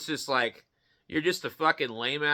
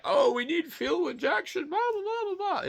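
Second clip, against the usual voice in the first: high, whiny mock voice, way above his own; mocking carmakers. Ranting about fuel injection, mocks carmakers in high whiny voice. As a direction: sneer at the makers by aping them in a silly high squeal. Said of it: "And then they're like".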